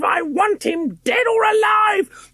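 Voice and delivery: high, loud witchy shriek; shrieking ghost hunter. A narrator's reading. Ghost hunter shrieking after the fleeing boy. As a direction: screech it like a hunting hag. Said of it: "He heard her shriek".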